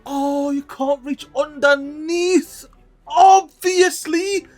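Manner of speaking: high, slow, whimpering voice; whiny mock crying. Gamer whining at the blocked path, high mock crying. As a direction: whine it out like a sulking child. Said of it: "But as I was saying".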